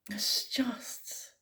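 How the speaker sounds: breathy whisper of sorrow; whispered grief. Real, not acted. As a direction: whisper it, overcome. Grief about children killed.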